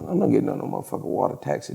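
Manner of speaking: fast, soft hushed refusal; whispered refusal. Retold or imitated. Teller refusing the water taxi, fast hushed voice. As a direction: hiss your refusal quickly.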